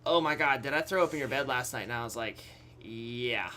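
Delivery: ordinary delivery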